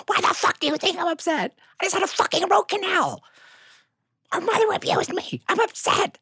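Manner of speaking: very high, squeaky wailed rant; hammed-up tearful outburst; hysterical mock crying. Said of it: "I'm losing my shit".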